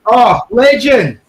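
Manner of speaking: loud, slow, drawn-out cheer; excited cheer. Real, not acted. Greeting the raided streamer, excited loud cheer. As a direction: hail him like a mate.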